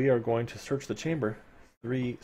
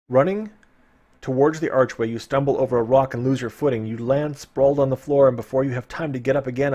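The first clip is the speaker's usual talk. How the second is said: louder, urgent adventure reading; dramatic story narration; 3 semitones higher, 6 dB louder than usual. Acted. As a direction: push the danger of the rockfall.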